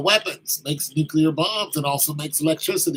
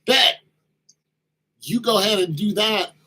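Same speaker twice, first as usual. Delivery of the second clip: mock defiant retort; 5 semitones higher, 4 dB louder than usual. France defiantly answering the ultimatum.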